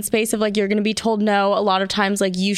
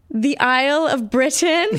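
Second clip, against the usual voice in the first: put-on higher-pitched, shaky-credibility British accent; unconvincing British accent; attempted British accent; 4 semitones higher than usual. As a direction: try a British accent and bluff it. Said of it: "in a British accent".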